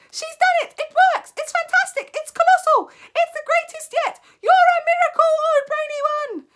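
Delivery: very high, loud shrill cheers; cheering witches. Acted. Witch audience cheering their leader, very high. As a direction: ecstatic crowd of witches.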